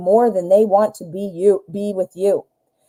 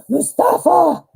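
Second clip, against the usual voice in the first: gasp, then Mustafa! high and drawn out; gasp and exclaim; 8 semitones higher, slower than usual. Real, not acted. Host suddenly fired up for the caller, gasping exclamation. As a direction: catch your breath and cry his name.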